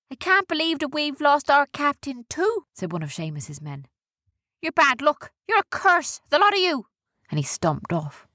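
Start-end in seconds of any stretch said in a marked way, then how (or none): 0.1-2.6 high-pitched crewman voice; upset crewman. A narrator's reading. One of Seamus's men over his lost captain, high and upset. 4.6-6.9 high-pitched accusing outburst; angry blame. A narrator's reading. Seamus's man blames the others before stomping off, high and angry.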